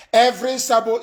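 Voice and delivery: bursts out loud and high on the name; sudden shout. Real, not acted. Leader threatening traitors, sudden loud shout. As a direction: hurl the words out as a threat.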